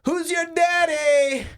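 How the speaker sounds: high, drawn-out comic holler; mock shout. Husband retelling his bedroom outburst, high drawn-out mock shout. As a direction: shout it out long and high, hammed-up. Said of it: "I guess shouting out".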